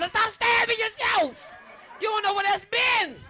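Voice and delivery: a panicked dad shouting at his son; high, loud father yell. Retold or imitated. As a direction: shout it high and slow, alarmed.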